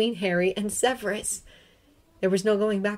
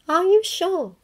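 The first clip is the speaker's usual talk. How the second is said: put-on higher, louder relieved young woman; Hermione's voice; 11 semitones higher, 4 dB louder than usual. A narrator's reading. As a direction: call out with relief, pitched up.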